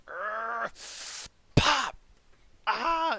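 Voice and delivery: loud pained grunt then yell; guttural scream. Acted. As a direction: strain and scream as the arm pops. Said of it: "lets out a guttural scream".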